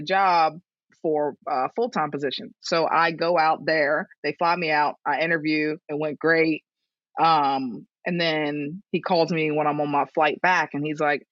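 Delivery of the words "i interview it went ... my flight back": ordinary talk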